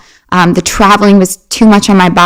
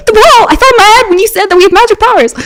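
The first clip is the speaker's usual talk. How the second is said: high-pitched, fast, hammed-up insistence; freaked out; 14 semitones higher, faster than usual. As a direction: act an overexcited kid explaining in a rush. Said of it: "I, like, freaked out".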